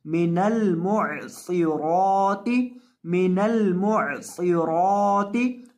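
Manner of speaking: मिनल मुअसिराती slow, मिनल run together as one word; joined recitation. Shown as a demonstration. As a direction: chant it slowly, linking the न into ल. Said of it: "नून को लाम के साथ जोड़कर और मिलाकर पढ़ेंगे". (धीमी तिलावत, नून और लाम मिलाकर)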